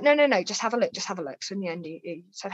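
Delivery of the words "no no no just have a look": pushing, higher and louder; no, no, no, open it now; insistent urging. Retold or imitated. Fiancé eagerly pressing her to open the parcel, insistent.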